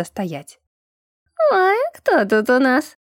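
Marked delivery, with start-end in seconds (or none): 1.5-3.0 high, thin, put-on little voice; drawn-out, high-pitched cooing question. A narrator's reading. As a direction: pipe up in a tiny high voice. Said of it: "низеньким тоненьким голоском".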